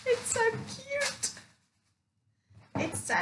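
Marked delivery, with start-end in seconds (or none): 0.1-1.3 squeal. Real, not acted. Gushing over shoes in haul.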